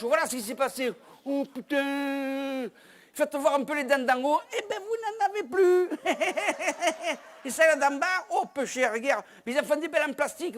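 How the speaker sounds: high mock-sympathetic voice with laughs; a touch of a gleeful onlooker fussing over the teeth; mocking bystander. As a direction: console him while laughing at him. Mock bystander consoling injured man.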